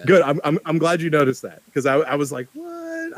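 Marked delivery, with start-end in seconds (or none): 2.5-3.2 surprised. Retold or imitated. Retelling his own reaction.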